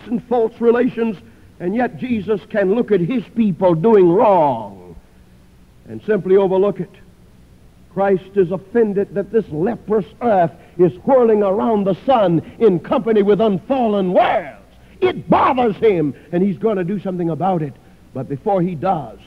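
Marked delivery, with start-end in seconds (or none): none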